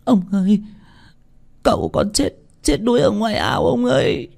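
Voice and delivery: high, broken blubbering maid; tearful, whimpering. Acted. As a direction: blubber the terrible news to the master. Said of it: "Con sen mếu máo". (giọng mếu máo, the thé)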